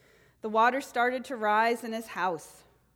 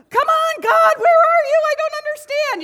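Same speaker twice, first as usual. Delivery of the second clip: exasperated yell; 14 dB louder than usual. Retold or imitated. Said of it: "Jim Carrey's like".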